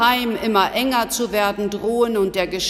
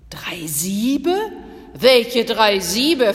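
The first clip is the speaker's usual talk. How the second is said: surprised; 3 dB louder, slower than usual. Acted. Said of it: "fragte der Mann überrascht".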